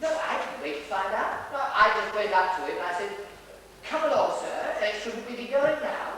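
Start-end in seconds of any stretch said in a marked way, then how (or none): 3.8-6.2 higher, coaxing nanny. Acted. Dresser coaxing Sir away, in his best nanny voice. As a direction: gently chivvy a wayward charge along. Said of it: "You know, in my best nanny voice".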